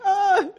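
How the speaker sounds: high, loud laughing shriek; mock shriek. Disciples shrieking at the sinking, high and loud. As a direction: shriek along with the panicked crowd. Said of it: "all the disciples like".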